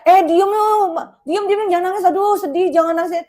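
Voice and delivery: put-on exasperated adult telling a child to shut up; mock impatient hushing. Shown as a demonstration. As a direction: snap at the crying kid to stop. Bad example of hushing child.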